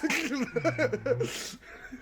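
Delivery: high burst of laughter; real high-pitched laugh. Real, not acted. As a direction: crack up at your own bit. Cracks up at own bit.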